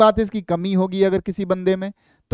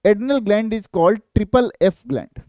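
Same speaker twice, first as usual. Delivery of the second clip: slow dictation; 3 semitones higher, much slower than usual. Shown as a demonstration. Dictating a key exam line.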